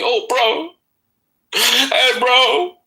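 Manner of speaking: high-pitched whiny crybaby impression; mock crying. Mocking a rival who cried to him, high whine. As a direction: imitate a man pleading through whiny tears. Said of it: "You cried to me".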